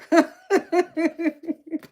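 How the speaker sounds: loud, high cackling laugh; real high-pitched laugh, loud and rolling; hearty laugh. Real, not acted. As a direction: burst out laughing at yourself. Laughing at her accent.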